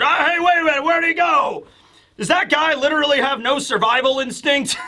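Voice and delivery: high, startled imitation of an old man; acted disbelief, pitched well up; alarmed outcry. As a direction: call after someone in mock alarm.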